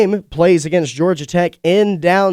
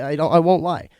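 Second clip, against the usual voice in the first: ordinary delivery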